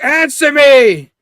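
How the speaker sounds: loud, high, drawn-out shout; furious yell. Acted. Spidey yelling at beaten Norman for an answer, furious. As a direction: bellow it in acted rage.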